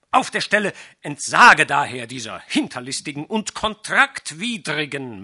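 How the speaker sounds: loud, high, furious lecture; angry sorcerer. A narrator's reading. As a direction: threaten him, building in rage. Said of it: "rief Pinais".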